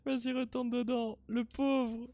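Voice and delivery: high, put-on cutesy voice. Player cooing at the little pot character in a high cute voice. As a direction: coo it like baby talk.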